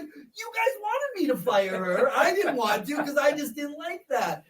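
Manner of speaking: high, loud, laughing self-defence; laughing protest. Retold or imitated. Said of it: "and I was like".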